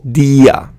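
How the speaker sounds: dia as DEE-ah, punched on DEE; first-syllable stress. Shown as a demonstration. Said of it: "put that emphasis on that first syllable".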